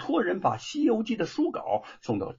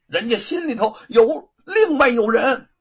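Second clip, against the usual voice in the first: gritting his teeth; 8 semitones higher, 7 dB louder than usual. Acted. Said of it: "终于咬咬牙说".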